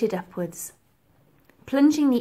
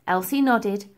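ordinary delivery

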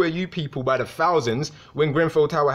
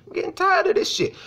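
exasperated squeal. Real, not acted. Rant peaks in high squeal.

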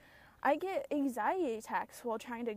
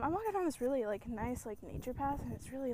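normal talking voice

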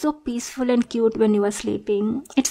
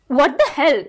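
higher, louder offended cry; a stalker outraged her crush thought she'd kill; indignant outburst; 5 semitones higher, 4 dB louder than usual. Acted. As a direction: lash out, stung and offended. Hurt that he feared her.

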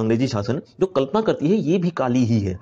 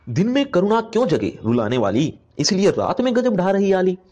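lifted, declaiming verse voice; poem recitation; 7 semitones higher than usual. Acted. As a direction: read the couplet as poetry, rising. Teacher recites poem lines.

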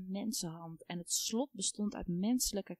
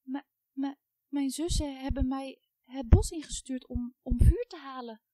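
stammering, voice rising louder in fright; frightened stammer; 4 semitones higher, 9 dB louder than usual. A narrator's reading. Terrified girl stammers to the witch, growing louder. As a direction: stutter out the words, panic building. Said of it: "wist met moeite te stamelen".

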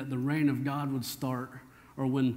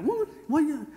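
high, sharp mock-indignant "Woman"; sassy retort; 13 semitones higher, 4 dB louder than usual. Husband mock-snapping at his wife Melanie. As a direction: snap back at your wife with attitude. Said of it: "if I talk to Melanie like that".

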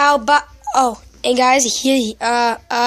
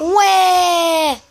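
imitating a wailing baby, mock cry; baby cry imitation; 2 semitones higher than usual. Shown as a demonstration. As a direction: wail "wah" like a crying baby. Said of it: "What does a baby say?".

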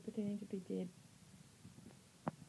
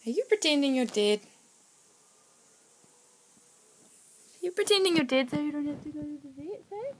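loud teasing; 2 semitones higher, 10 dB louder than usual. Real, not acted. Teasing the still puppy.

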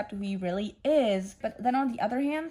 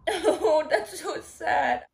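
high, tearful whimper, slowing down; 6 semitones higher, 3 dB louder, much slower than usual. Real, not acted. Reader saddened by the ending, tearful. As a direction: whimper it as the tears come. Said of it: "Stop crying".

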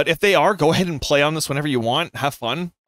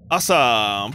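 usual speaking voice

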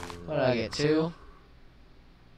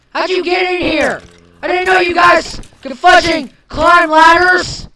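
much higher, loud panicked scream; panicked shriek; 18 dB louder than usual. Real, not acted. Gamer panicking as mobs climb in.

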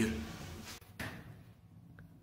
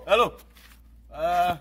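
ordinary talk

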